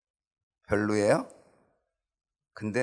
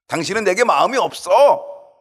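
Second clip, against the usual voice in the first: Delilah's complaint; 9 semitones higher, 10 dB louder than usual. Acted. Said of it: "이렇게 하는 거죠".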